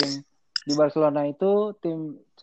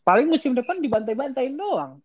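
sombong; 8 semitones higher than usual. Real, not acted. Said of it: "sombong banget gue".

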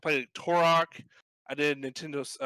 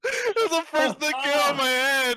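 laughing; 12 semitones higher, 7 dB louder than usual. Real, not acted. Laughing explanation.

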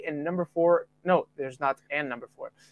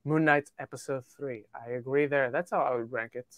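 normal talking voice

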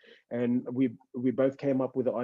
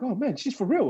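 high, impressed surprise; surprised; 9 semitones higher than usual. Retold or imitated. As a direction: realize it's genuine. Own reaction quoted.

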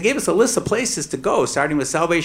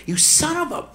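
high, fast indignant rant; mock outrage; 13 semitones higher than usual. Father silently raging at the lecturing judge, high and heated. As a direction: let the fury spill out.

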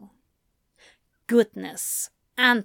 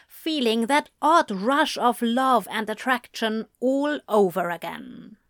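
usual speaking voice

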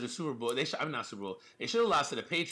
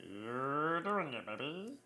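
slow drawl; 4 dB quieter, much slower than usual. Acted. Slow drawn-out praise.

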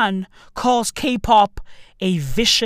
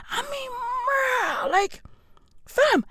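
much higher and slow, stretched out; high, slow, whining bruh; high drawn-out disbelief; 13 semitones higher, 6 dB quieter, slower than usual. Acted. Outraged at the celebrity.

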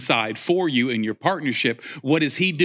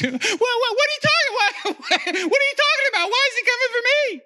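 very high, laughing panicky squeak; high-pitched nervous mock panic; squeaky scared voice. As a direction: act like a frightened man whose voice cracks up. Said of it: "the drama comes out in me and my voice".

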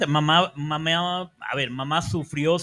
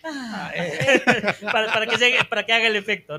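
laughing; 5 semitones higher, 5 dB louder than usual. Real, not acted. Laughs mid-explanation.

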